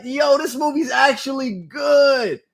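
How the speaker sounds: high, drawn-out astonishment; freaking out, amazed. Retold or imitated. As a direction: stretch it out in happy disbelief. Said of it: "And I was freaking out".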